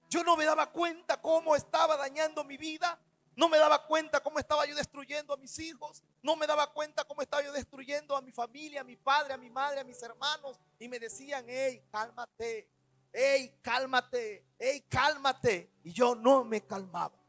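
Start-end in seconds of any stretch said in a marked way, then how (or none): 0.1-10.0 preaching at full force. Real, not acted. 11.5-15.7 slow pleading. Retold or imitated. Family pleading with him to calm.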